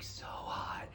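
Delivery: breathy confiding whisper; whispered crush. Acted. Comic telling the crowd her crush, whispered. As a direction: drop to a giddy secret whisper.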